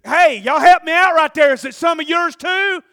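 high, loud, worked-up preacher shout; full-force preaching. Real, not acted. Preacher confessing his own life story, shouted. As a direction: holler it out to the whole church.

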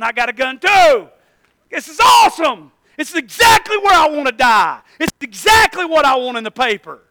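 loud, hammed-up enthusiasm; over-the-top retold outburst; sarcastic mock excitement. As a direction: exclaim it with exaggerated irony. Acted sarcastic panic as guns appear.

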